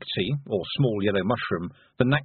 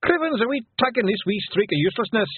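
higher Scots pixie voice; broad Scottish accent; 11 semitones higher than usual. Acted. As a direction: Rob Anybody's Scottish brogue. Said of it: "said Rob".

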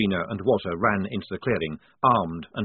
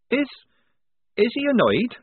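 high, halting anxious question; falter, then ask timidly on a rising tone; nervous quaver; 14 semitones higher, 5 dB louder than usual. A narrator's reading. Polly asking about the prince, quavering. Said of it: "Polly quavered".

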